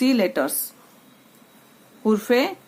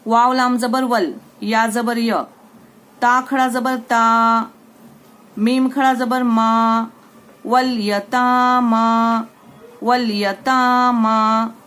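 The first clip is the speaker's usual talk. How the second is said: louder, slow spelling-out recitation; syllable drill; 3 dB louder, slower than usual. Shown as a demonstration. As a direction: sound out each part, then join it.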